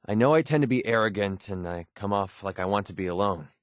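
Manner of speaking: ordinary talk, nothing marked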